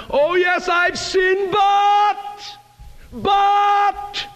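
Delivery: very high, strained excuse-making; mock protesting. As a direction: cry out the objection, mimicking a sinner. Preacher mimics excuse-making sinner.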